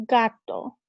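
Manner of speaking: Gato said loud with a hard G. Shown as a demonstration. Teacher repeating gato to the class. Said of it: "a hard G sound".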